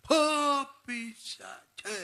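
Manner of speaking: a drawn-out, high mock roar at the devil; imitating father's stretched roar; roaring rebuke. Father rebuking the devil over a crying child, imitated roar. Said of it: "ഒരൊറ്റ അമറായിരിക്കും".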